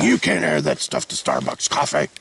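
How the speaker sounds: a hammed-up purist scolding the vlogger; imagined coffee snob. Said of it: "someone out there who's like".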